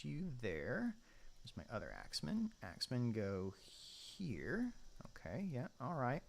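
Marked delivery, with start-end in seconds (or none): none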